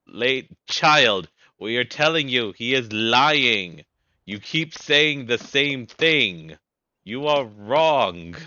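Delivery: loud, slow, heavy rebuke; loud slow stern knight. Acted. Stern knights lecturing the child, loud and slow. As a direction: lay down the law word by word.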